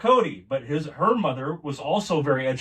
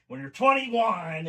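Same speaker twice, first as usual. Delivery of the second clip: high, slow in-your-face father; dad's imitation, high and pointed; imitating his dad; 7 semitones higher, slower than usual. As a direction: mimic dad lecturing, pitched way up. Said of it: "I'm gonna do an imitation of my dad".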